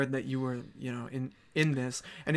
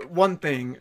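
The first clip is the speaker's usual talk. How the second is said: ordinary talk, nothing marked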